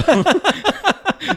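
loud, high cackle breaking out; can't hold the laugh in; burst of high laughter. Real, not acted. Laughing at his own comeback to the guy, loud and high.

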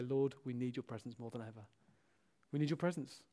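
low, soft plea to God; hushed prayer. Shown as a demonstration. Preacher showing church how to pray, hushed plea. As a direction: drop right down into a quiet prayer.

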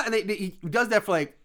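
normal talking voice

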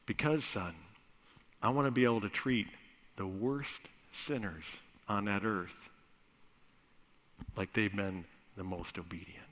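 soft and low, gentle; tender explanation. Acted. Father gently telling his son why, softly. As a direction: explain it lovingly.

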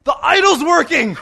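high, loud delighted exclamation; amazed discovery. Retold or imitated. Driver to friend after the crash, high amazed delight. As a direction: land it as an astonished punchline.

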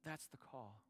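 soft, settling repeat of "That's the call"; quiet echo. Real, not acted. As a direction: let "That's the call" fall away softly. Repeated closing line, softer.

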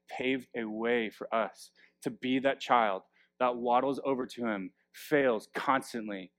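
normal talking voice

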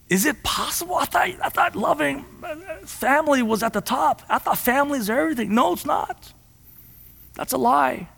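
mock disbelief, then flat denial; rising fervor. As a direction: build the preacher's fervor. Preacher rises to a peak.

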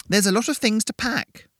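put-on high, strained old woman. A narrator's reading. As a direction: squeak it out like an odd old lady. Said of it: "the woman said".

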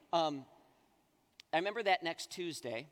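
usual speaking voice

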